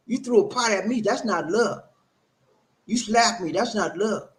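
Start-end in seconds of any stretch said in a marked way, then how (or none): none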